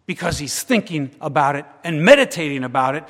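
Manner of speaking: normal talking voice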